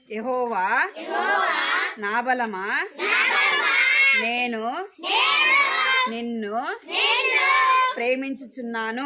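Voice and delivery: యెహోవా, నా బలమా, నేను, నిన్ను called out loud, word by word with pauses; loud group recital. Shown as a demonstration. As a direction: call out యెహోవా నా బలమా so the whole class joins in. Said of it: "అందరు పలకాలి". (యెహోవా నా బలమా గట్టిగా పలకడం)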